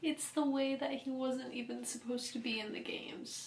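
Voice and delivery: hushed, sad whisper; whispering over a heartbreaking book; whispered sorrow. Real, not acted. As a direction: whisper it like it hurts. Grieving over the character's fate.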